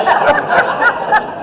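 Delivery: a quick, airy laugh at her own joke; high breathy giggle. Real, not acted. Speaker amused by the compliment, high breathy giggle. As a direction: let a high laugh slip out.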